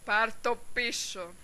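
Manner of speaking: slow, deliberate demand; slow menace. Acted. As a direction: draw out each word as a warning. Demands insult be withdrawn.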